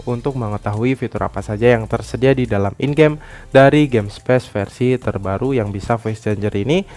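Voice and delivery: ordinary talk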